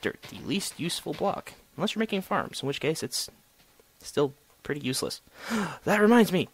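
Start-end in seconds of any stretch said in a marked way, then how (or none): 5.5-6.5 gasped, higher and louder; sudden realization. Real, not acted. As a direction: blurt it out with a gasp. Gasp, sudden idea.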